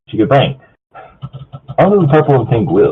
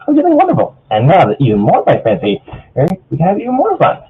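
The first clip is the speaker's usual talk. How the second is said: Pinkie; 6 semitones higher than usual. Acted. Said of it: "Pinkie said".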